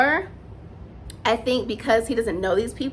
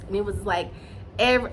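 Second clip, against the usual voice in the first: ordinary talk, nothing marked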